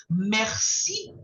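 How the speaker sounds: Merci with a throat R and a rising end; model merci. Shown as a demonstration. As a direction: model Merci for the student to repeat. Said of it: "repeat after me".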